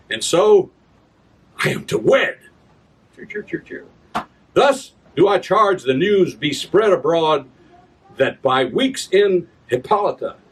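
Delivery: grand stage recitation of a royal proclamation; theatrical verse reading. Acted. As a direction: proclaim it like a herald to the crowd. Reads his Shakespeare adaptation.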